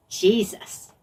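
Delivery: plain reading voice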